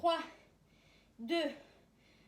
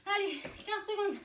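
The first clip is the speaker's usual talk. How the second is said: barking gym coach; 4 semitones higher than usual. Real, not acted. Coach counting down hard set.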